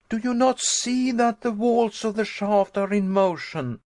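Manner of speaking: high, loud, relentless accusing questions; fierce angry tirade. Acted. Nephew listing signs of earthquake to uncle, fierce and loud. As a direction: hammer him with every sign of danger. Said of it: "I cried in fierce and angry tones".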